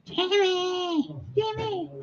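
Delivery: imitating a mother-in-law yelling at her son. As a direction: mimic a nagging mother calling her son. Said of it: "yelling at him".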